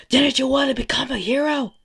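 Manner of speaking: high, very loud angry yell; taunting shout. Acted. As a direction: yell it while pounding the ground. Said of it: "he's just pounding on the ground saying".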